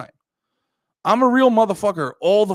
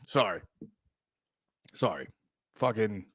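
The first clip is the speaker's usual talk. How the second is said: low, quiet sorry; subdued apology; 11 semitones lower, 9 dB quieter than usual. Real, not acted. Host apologising to chat after ranting, subdued. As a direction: drop right down into a small, low apology.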